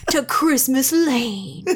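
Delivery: slow, dramatic trailer announcer; mock coming-attractions narrator; movie trailer voice. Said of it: "he had a movie previewer voice".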